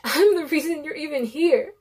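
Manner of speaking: loud, high guilty outburst; anguished shout. A narrator's reading. Narrator confessing loudly to the mother, anguished shout. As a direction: cry it out loud in self-blame. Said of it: "I shout".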